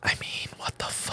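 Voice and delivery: unvoiced shocked whisper; whispered aside. Real, not acted. Friend whispering in shock about chips. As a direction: breathe it out in disbelief.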